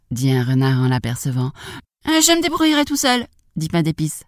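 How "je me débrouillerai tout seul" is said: high, cocky little voice; gingerbread man character. Acted. Gingerbread man turns down the fox's help, high cocky voice. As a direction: brush off the offer in a high little voice.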